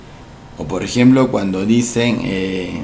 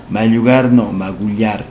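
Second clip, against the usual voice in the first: normal talking voice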